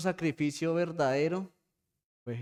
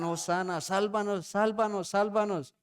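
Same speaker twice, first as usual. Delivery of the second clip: crowd shouting; 5 semitones higher than usual. Retold or imitated. Preacher voicing the palm crowd, a mock rising shout. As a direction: call it out like the cheering crowd. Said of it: "Muchos gritaban".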